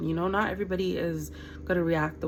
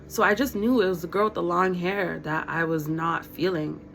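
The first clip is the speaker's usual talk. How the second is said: normal talking voice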